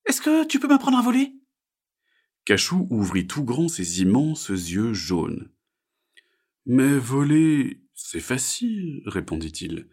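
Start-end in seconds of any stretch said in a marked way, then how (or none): 0.1-1.3 very high, louder frog voice; little frog. Acted. Little frog asks to learn flying, high character voice. 6.7-9.0 higher, slow, drawn-out bird voice; bird character. Acted. Bird tells frog flying is easy, slow character voice.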